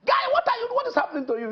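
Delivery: high-pitched, animated questioning; policeman calling out. Acted. Policeman asks crying boy what happened, high and urgent. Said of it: "one policeman came and met him and said".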